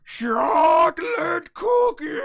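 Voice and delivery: high, very loud, stretched-out cartoon yell; huge drawn-out mock-monster bellow; hammed-up ecstatic scream. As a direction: scream it like a crazed cookie lover. Acts out Doo yelling cookie.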